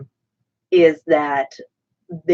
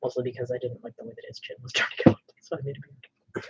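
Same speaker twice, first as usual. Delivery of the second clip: whispering; 5 semitones lower, 10 dB quieter, faster than usual. Real, not acted. Aside about the beard.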